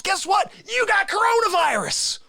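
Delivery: high-pitched dramatic punchline; mock alarm. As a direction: deliver the bad news in a high, exclaiming voice. Dramatic punchline of infection story.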